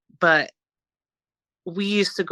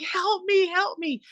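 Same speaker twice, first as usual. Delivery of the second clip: retold cry for help; 12 semitones higher, slower than usual. Sibling mimics brother's screaming for help, high but held back. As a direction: imitate a scream at half volume, high. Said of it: "he was, like, screaming".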